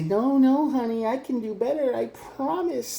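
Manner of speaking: high, wheedling promise; pleading partner impression. Mocking the partner's high pleading promise. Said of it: "They're gonna do it in that exact voice".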